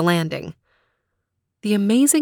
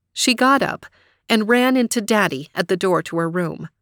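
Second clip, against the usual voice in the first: ordinary delivery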